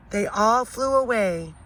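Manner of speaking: ordinary delivery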